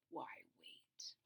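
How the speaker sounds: breathy unvoiced menacing whisper; sinister whisper. Tyrone whispering from behind the friends, menacing. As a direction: hiss it from behind, unseen. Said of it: "a voice behind them that said".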